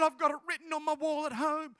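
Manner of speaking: retold thrilled insistence; excited amazement. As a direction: gush over the coincidence. Girl amazed at scripture coincidence.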